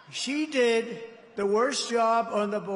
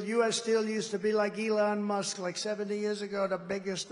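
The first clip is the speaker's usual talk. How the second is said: normal talking voice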